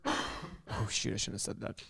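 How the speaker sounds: gasp, then very soft, low aside; hushed slip. Real, not acted. Podcast host regrets revealing a buyer detail, hushed slip. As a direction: catch yourself and drop almost to nothing.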